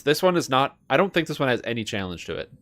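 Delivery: usual speaking voice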